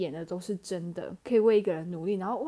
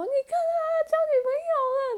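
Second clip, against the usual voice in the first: very high, louder wailing sob; crying wail; 6 dB louder than usual. Retold or imitated. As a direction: howl it out through tears. Sobbing to sister.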